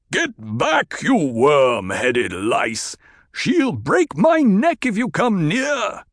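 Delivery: high, loud roar at his men. Acted. Captive chieftain roars at his men to back off. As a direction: bellow from a chokehold. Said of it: "The roar of Stilgar's voice".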